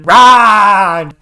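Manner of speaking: loud, high drawn-out battle roar; hammed-up hero's scream. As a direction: belt out a mock war cry. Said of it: "He's screaming".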